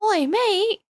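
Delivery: high, playful mock Australian Oi, mate; a jokey Aussie greeting; lightly put-on Australian accent. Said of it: "that still sounds Australian".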